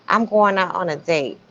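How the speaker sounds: ordinary delivery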